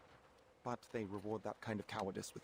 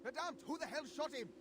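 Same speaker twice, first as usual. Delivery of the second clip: high startled shout of an officer; shocked officer voice. Acted.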